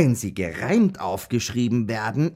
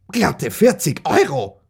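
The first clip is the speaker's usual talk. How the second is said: high, punched-out shock at the sum; aghast exclamation; 7 semitones higher, 4 dB louder than usual. Acted. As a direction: blurt the total, pitch jumping up. Adds up the lost fee.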